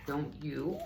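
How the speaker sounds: usual speaking voice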